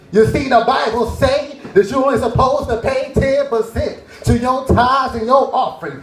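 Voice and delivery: mock pastor pitch; imitating a pastor. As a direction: sell the tithe like a pastor. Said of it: "like all pastors do".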